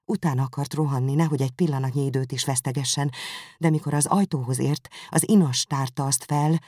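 ordinary delivery, nothing marked

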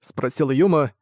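usual speaking voice

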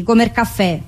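usual speaking voice

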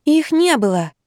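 small high-pitched squeak. A narrator's reading. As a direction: squeak out a timid denial. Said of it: "пискнула я". (тоненько пискнуть)

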